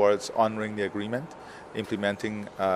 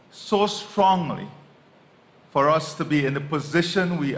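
forceful speech; 7 semitones higher, 5 dB louder than usual. Real, not acted. President pressing the case for reparations, forceful rallying speech. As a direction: drive each phrase home to the hall.

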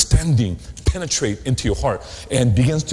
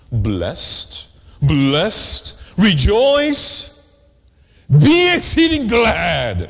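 crescendo; 5 semitones higher, much slower than usual. Shown as a demonstration. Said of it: "I like to say crescendo".

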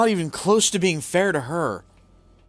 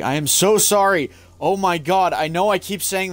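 higher and louder, stressing so; exasperated apology; 6 semitones higher, 5 dB louder than usual. Real, not acted. Loud, high, exasperated apology.

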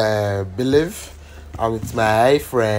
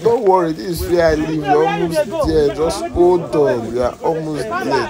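put-on coaxing girl voice; 6 semitones higher, 5 dB louder than usual. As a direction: lure him along sweetly. Spirit girl reassuring high.

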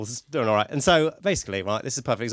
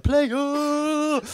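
high shouted club greeting; player shout; 13 semitones higher than usual. Retold or imitated. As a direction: yell it like a nightclub lad. Club players greeting each other.

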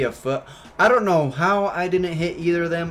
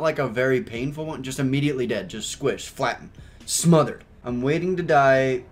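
normal talking voice

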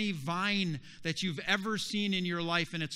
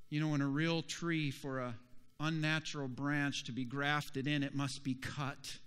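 ordinary talk

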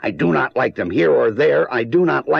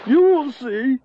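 exasperated giving in; 9 semitones higher than usual. Acted. Grump caving to Sam at last, exasperated high. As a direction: throw up your hands and give in.

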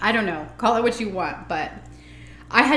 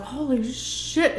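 slow, drawn-out and pitched up; awed; 6 semitones higher, much slower than usual. Retold or imitated. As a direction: let it melt out slowly in awe. Hearing his voice first time.

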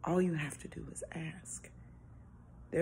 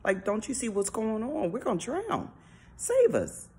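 higher, louder panicked pleading; panicked disciples; 6 semitones higher, 5 dB louder than usual. Retold or imitated. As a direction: cry out to a sleeping Jesus in fear. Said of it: "and they're like".

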